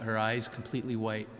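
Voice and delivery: ordinary delivery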